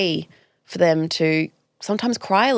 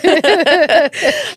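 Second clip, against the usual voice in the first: laugh; 12 semitones higher, 10 dB louder than usual. Real, not acted. Laughs at own demo.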